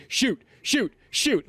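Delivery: high, loud repeated command; yelling at camera. Retold or imitated. Author retelling the photo shoot, yelling at the camera. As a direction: re-enact yelling, pitched well up. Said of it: "I'm yelling at the fucking camera".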